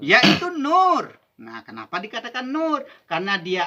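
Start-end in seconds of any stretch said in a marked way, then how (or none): 0.0-1.1 slow, higher and louder on "nur"; solemn emphasis. Real, not acted. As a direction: dwell on "Yaitu nur" as a revelation. Preacher reveals key term. (penekanan khidmat pada "nur", pelan dan keras)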